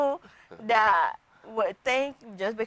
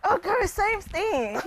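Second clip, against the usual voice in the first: breezy patient; 9 semitones higher, slower than usual. Retold or imitated. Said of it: "guy brushes off nurse title".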